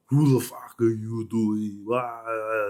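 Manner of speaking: loud, slow angry yell into the phone. Retold or imitated. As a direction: bellow down the line at the caller. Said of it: "This guy's yelling at the phone".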